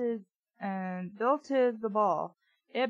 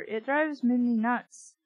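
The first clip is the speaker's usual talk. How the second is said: usual speaking voice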